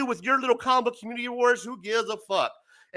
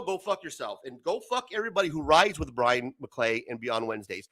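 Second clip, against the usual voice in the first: ordinary talk, nothing marked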